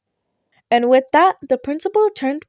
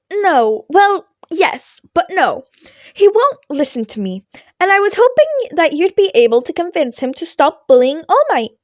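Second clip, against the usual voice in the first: higher, louder voice for the cheerful principal; principal Nezu; 6 semitones higher, 4 dB louder than usual. A narrator's reading. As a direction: lift the voice up for the small principal.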